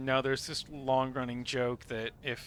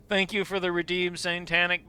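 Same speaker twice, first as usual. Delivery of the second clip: ordinary delivery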